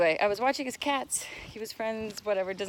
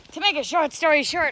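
a hint of the old agent, a bit louder and punchier; agent's catchphrase; 5 semitones higher, 7 dB louder than usual. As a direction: lightly mimic the agent saying his line. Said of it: "as my agent Abbott Bloomberg used to say".

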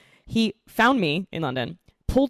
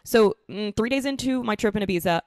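usual speaking voice

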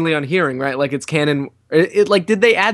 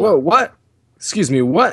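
double take; 4 semitones higher than usual. Real, not acted. Surprised reaction to a scene.

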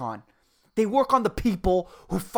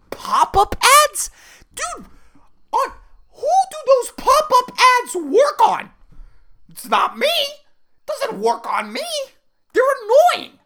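higher and louder, incredulous; exasperated rant; 13 semitones higher, 6 dB louder than usual. Real, not acted. Ranting to the crowd about pop-up ads, a high incredulous yell. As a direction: shout it in disbelief.

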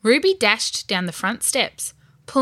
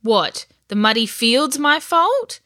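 slow, higher, rising protest; indignant retort; 5 semitones higher, 4 dB louder than usual. A narrator's reading. Ben expects more blame from his sister, indignant. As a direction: ask it with wounded disbelief. Brother retorts sarcastically in story quarrel.

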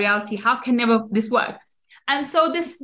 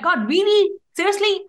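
mild mock disbelief, pitched up; doubting; 7 semitones higher than usual. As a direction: question it in a higher, doubting voice. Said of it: "But we question God".

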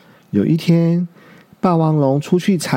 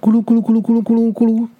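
rolling-down noise; 8 semitones higher, much faster than usual. Acted. 小鹿滚下石头山.

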